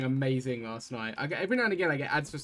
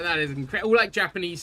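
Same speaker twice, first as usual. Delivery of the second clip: excited; 4 semitones higher, 9 dB louder than usual. Retold or imitated. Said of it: "I was like".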